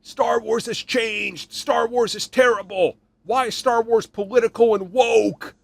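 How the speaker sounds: mocking fans. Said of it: "It's like modern Star Wars fans".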